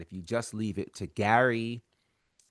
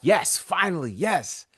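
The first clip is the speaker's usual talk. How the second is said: excited; 8 semitones higher, 3 dB louder, slower than usual. Retold or imitated. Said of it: "you were like".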